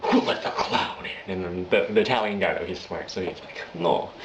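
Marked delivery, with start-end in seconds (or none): 0.1-1.3 mock-angry, drawn-out gangster line; half-hushed angry mobster. Gangster reacting to the Joker, mock angry. 3.7-4.1 lightly put-on Russian accent; measured mobster with a foreign, Russian-style accent. Mobster hearing out the Joker, light Russian accent. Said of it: "Or he's Russian, actually".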